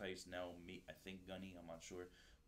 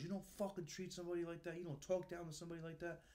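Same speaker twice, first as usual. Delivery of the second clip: angry scolding; 9 semitones higher, faster than usual. Retold or imitated. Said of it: "He goes".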